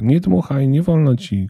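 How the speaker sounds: high, loud, urgent plea; desperate cry. A narrator's reading. Elf as the girl is about to blow the whistle, desperate cry. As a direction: cry out desperately to stop someone. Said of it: "krzyknął rozpaczliwie elf".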